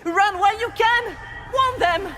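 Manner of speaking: high, loud warning cry; panicked high shout of alarm; desperate shout. Acted. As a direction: yell to warn everyone. Memory of the temple attack.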